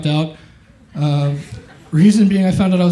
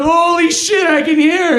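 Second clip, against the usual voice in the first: high, amazed shout; excited exclaim; 11 semitones higher, slower than usual. Acted. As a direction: cry it out in wonder. Joke character exclaims.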